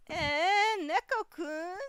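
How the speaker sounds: high-pitched, drawn-out surprised query; questioning character voice. Acted. Reading out what she says to the cat, questioning. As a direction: call to the cat with a high, puzzled lilt. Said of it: "And then she says".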